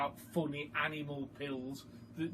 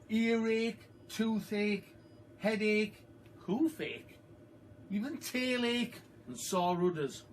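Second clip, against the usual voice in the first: label read out; 7 semitones higher than usual. A narrator's reading. Reader reading the horse pill label, very slow and grand. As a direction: stretch each word out like a proclamation.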